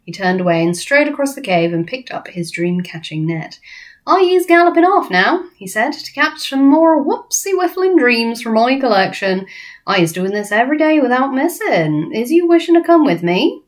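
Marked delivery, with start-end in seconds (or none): 4.1-5.4 high, loud, rustic giant voice; lively giant, pitched up; friendly giant. Acted. BFG telling Sophie he's off, friendly giant voice. 5.9-13.6 higher, lilting giant voice; swooping, lively giant invitation; friendly giant, eager. Acted. BFG inviting Sophie along dream-catching, eager giant voice.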